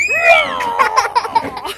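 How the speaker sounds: high loud playful roar; dinosaur roar. Pretend. Mum roars like a dinosaur to amuse the babies. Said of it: "Dinosaur says".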